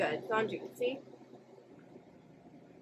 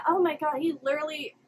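normal talking voice

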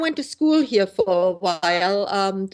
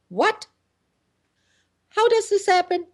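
shocked disbelief; 12 semitones higher than usual. Retold or imitated. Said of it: "I said".

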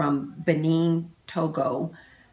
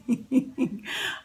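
soft airless giggle; a quick excited laugh under the breath; breathy giggle; 6 semitones higher, 3 dB quieter than usual. Real, not acted. Giggle while opening results.

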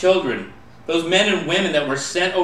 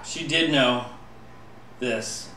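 poem recitation; 4 dB quieter than usual. Acted. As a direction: tell it softly like a tragic ballad.

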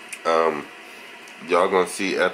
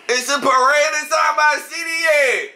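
high, loud shouted parade jingle; yelled jingle; 4 dB louder than usual. Acted. Dad yells his jingle early in the morning. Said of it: "Why you be yelling?".

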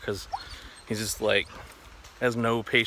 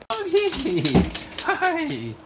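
talking to a dog. Real, not acted. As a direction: coo at the puppy in a high voice. Talking to his dog Keiko.

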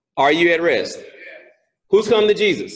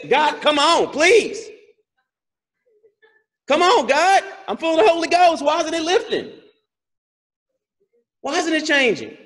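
high-pitched, exasperated begging; acted-out desperate prayer; pleading; 12 semitones higher than usual. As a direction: beg God as a suffering apostle. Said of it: "I pleaded with the Lord".

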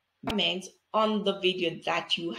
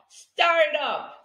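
bright and higher, "stir" blended whole; cheery payoff; 8 semitones higher, 5 dB louder than usual. Shown as a demonstration. Teacher cheering the blended word for a child. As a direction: pop out the finished word. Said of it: "Tell me what you get".

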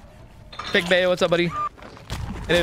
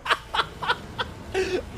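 unvoiced breathy laugh; 7 dB quieter than usual. Real, not acted. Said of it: "it sounded like he was laughing at me".

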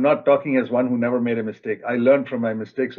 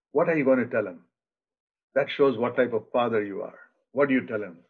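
ordinary talk, nothing marked

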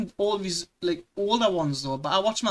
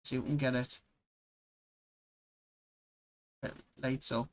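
quiet murmur; 5 semitones lower, 9 dB quieter than usual. Real, not acted. Peering at dark footage.

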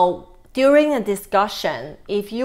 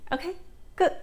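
usual speaking voice

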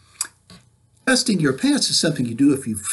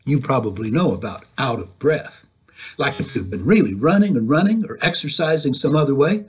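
ordinary delivery, nothing marked

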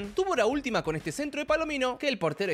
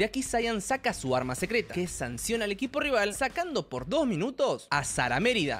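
ordinary talk

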